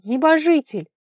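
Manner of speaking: high, loud exclamation of joy; joyful cry. A narrator's reading. Vara finds someone in the fog, joyful cry. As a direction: cry it out with sudden delight. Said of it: "подскочила от радости".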